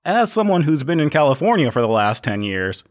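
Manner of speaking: ordinary talk, nothing marked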